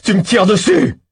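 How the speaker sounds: a loud, high, indignant yell; outraged shout. Acted. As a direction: scream the accusation back at him. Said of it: "Je hurle encore".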